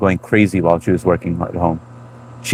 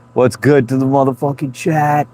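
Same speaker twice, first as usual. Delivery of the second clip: lightly voiced sitcom kid greeting the chat; a hint of Philip Drummond's entrance; Philip Drummond impression; 5 semitones higher than usual. Streamer greets chat as Philip, lightly voiced. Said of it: "That's the way Philip Drummond comes in".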